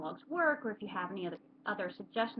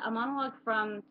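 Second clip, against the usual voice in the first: ordinary talk, nothing marked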